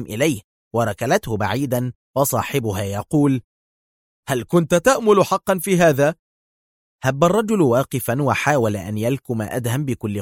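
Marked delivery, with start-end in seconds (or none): 4.3-6.1 higher-pitched, taunting challenge; taunting question. A narrator's reading. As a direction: ask it as a mocking jab. Hero taunts the beaten man.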